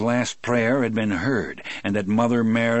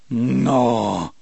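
horrified; 3 semitones higher, 6 dB louder than usual. Acted. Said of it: "Del said in a low, horrified voice".

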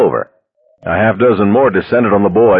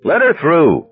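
high-pitched yell from the crowd; hoarse yell; 9 semitones higher than usual. A narrator's reading. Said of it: "A hoarse voice in the crowd yelled".

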